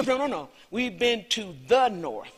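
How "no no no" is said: normal talking voice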